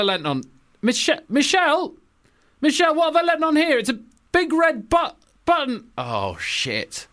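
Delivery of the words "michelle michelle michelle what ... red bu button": panicked Obama. Acted. Obama panics calling for Michelle.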